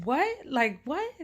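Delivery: high, disbelieving "what" repeated; incredulous what. Retold or imitated. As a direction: ask it again as if you can't believe it. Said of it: "I just kept saying".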